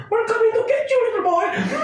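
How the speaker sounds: high, shrieking mock ghost voices; laughing high squeals of a cartoon ghost; spooky mock ghost. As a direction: ham up ghosts coming for a little boy.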